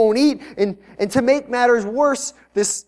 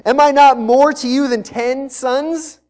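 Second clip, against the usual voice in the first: mock self-important husband; 4 semitones higher, 6 dB louder, slower than usual. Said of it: "he has, like, the best advice ever".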